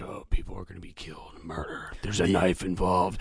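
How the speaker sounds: low, hushed horror narrator slowing at the end; mock spooky movie-trailer narration; creepy trailer voice. Imitating the old Halloween trailer guy, creepy voice. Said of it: "the guy with the creepy voice".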